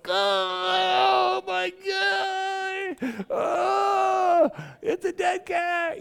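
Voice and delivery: high-pitched horrified wail, drawn out. Retold or imitated. Preacher re-enacting finding the dead cat, horrified wailing. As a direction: cry out in mock horror, way up high.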